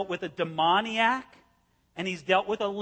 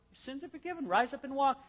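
soft, higher, smiling; smiling Jesus; 5 semitones higher, 4 dB quieter than usual. Retold or imitated. Jesus to the paralyzed man, soft and smiling. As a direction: say it gently with a big smile. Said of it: "with a great big smile".

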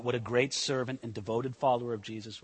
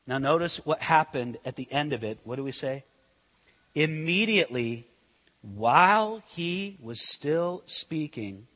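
ordinary talk

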